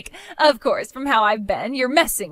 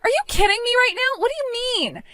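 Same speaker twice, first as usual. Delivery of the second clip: much higher, shocked disbelief; stunned; 10 semitones higher than usual. Acted. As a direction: reel from the news. Told brother cuts her off.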